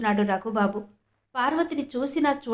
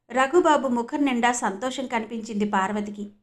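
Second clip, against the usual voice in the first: ordinary delivery, nothing marked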